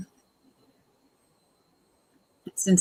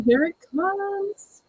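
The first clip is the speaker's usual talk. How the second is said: much higher and slow, a sing-song of doom; mock dramatic warning; 13 semitones higher, much slower than usual. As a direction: stretch it out like a spooky announcement. Bracing for the show's drama.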